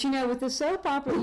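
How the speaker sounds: ordinary talk, nothing marked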